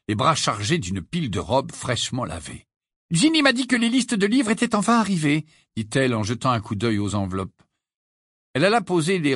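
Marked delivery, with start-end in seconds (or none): 3.1-5.4 high, lilting motherly voice; busy mother figure. A narrator's reading. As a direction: chat briskly as a mother coming in.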